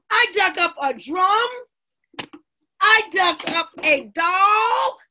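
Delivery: high, drawn-out excited reveal; thrilled showing off. A narrator's reading. Amma showing friends what she dug up, thrilled. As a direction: present each find with growing wonder. Child shows off dug-up treasures.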